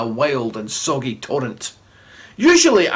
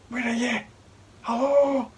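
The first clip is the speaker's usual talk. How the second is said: calling out; 8 semitones higher, 5 dB quieter than usual. Retold or imitated. Assistant calling for magician.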